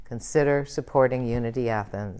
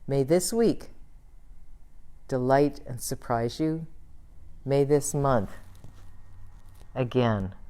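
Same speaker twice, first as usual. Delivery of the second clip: closing prayer; 3 semitones lower, 5 dB quieter than usual. Acted. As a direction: bless the world gently in prayer.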